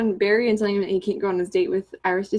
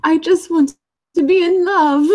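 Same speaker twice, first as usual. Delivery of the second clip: mock British leading man, higher and louder; terrible British accent; 9 semitones higher, 6 dB louder than usual. Imitating Tom Felton in a rom-com, in a bad British accent. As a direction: ham up a swoony British rom-com line. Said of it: "That's a terrible accent".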